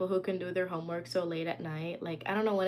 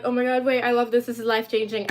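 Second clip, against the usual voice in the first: retold squeal of delight; excited gush; 3 semitones higher, 10 dB louder, faster than usual. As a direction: gush over it like a fan. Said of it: "And I was like".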